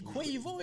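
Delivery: "Quavo" as kwaï-vo, sung out high; mother's intonation. Retold or imitated. Son showing how mother says the rapper's name, high kwaï-vo "[name]". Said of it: "avec l'intonation".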